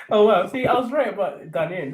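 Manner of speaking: usual speaking voice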